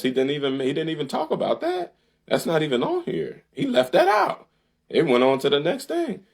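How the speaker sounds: high, incredulous pitch climbing; can't believe what he's reading; indignant outburst. Real, not acted. Host ranting to viewers about the plan, pitch soaring in disbelief. Exasperated rant at Biden's plan.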